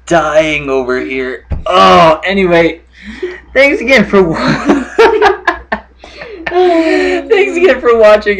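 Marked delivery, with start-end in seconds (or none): 3.5-8.4 high-pitched sign-off breaking into laughter; near tears laughing. Real, not acted. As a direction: try to sign off through real giggles. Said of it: "before I start crying anymore".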